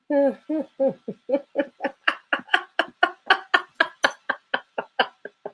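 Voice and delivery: long, high laughing fit, rising; uncontrollable laughter. Real, not acted. Streamer still amused by being blocked, uncontrollable laughter. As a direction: keep laughing helplessly, really unable to stop.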